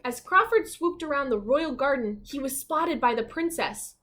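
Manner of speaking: lively, expressive storybook narration; reading to children. A narrator's reading. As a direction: read it like a bedtime picture book.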